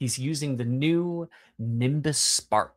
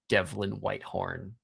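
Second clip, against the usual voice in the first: ordinary talk, nothing marked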